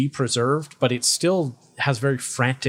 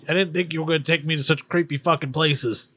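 slightly raised, griping character voice; complaining talking bag; 4 semitones higher, faster than usual. Acted. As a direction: grumble like a put-upon magic bag. Game master plays talking bag.

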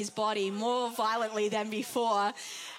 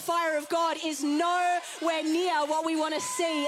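fiery preaching; 4 semitones higher than usual. Real, not acted. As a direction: preach it with rising fire. Preaching at full force.

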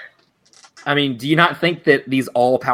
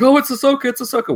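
much higher, gushing fan voice; mock fan excitement; 14 semitones higher, faster than usual. Fans guessing the wolf is Ahsoka, much higher and gushing. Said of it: "everybody was like".

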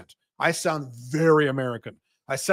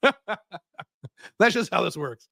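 talking through laughter, slowly; real laughing; much slower than usual. Real, not acted. Amused at his own accent bit, real laughing. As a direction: crack up while saying it.

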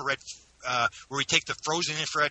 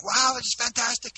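imitating excited kids, high-pitched wonder; high, awestruck mock-kid exclamation; amazed kids; 10 semitones higher than usual.

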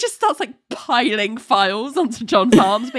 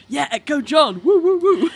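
high, hammed-up cheer; mock cheering; 7 semitones higher than usual. Coworkers cheering John on, mock high whoops. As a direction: cheer mockingly like the crowd. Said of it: "everyone seemed to think".

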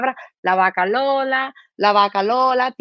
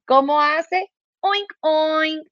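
pig oink imitation; 6 semitones higher, much slower than usual. Shown as a demonstration. Demonstrating pig sound.

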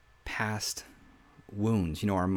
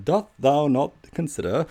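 put-on higher, louder old-English pomp; mock Shakespearean; 6 semitones higher, 7 dB louder than usual. Mocking a king speaking Shakespearean, hammed-up theatrical. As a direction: ham up a stage Shakespeare line. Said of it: "the king speaking Shakespearean".

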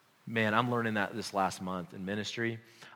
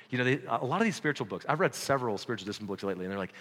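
ordinary delivery

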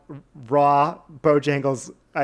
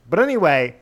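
ordinary talk

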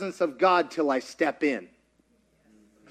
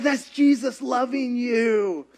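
tender reply; 4 semitones higher, slower than usual. Retold or imitated. Tells the amazed cashier.

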